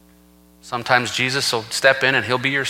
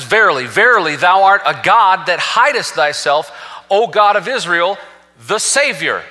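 loud, raised scripture proclamation; emphatic; 5 semitones higher, 4 dB louder than usual. Retold or imitated. Preacher quoting Isaiah to the congregation, emphatic. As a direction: punch "Verily, verily" and keep it ringing high. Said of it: "that's emphatic when the Bible says".

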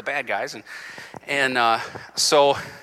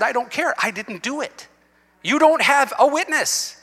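defiant insistence; 10 semitones higher, 4 dB louder than usual. Retold or imitated. Defiant answer to DA.

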